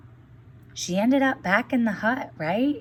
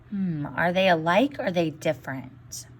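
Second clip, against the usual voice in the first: normal talking voice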